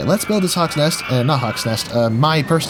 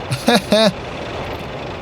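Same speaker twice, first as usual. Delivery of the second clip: silent wheezing giggle; breathy giggle; 7 semitones higher than usual. Real, not acted. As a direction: laugh on the breath. Watching guests fly.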